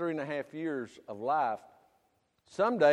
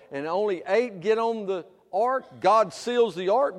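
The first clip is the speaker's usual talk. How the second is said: usual speaking voice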